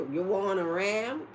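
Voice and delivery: slow, mock Texan "You want a ram?"; Texan accent. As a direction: drawl it like a Texas cowboy. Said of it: "Like a Texas Rangers".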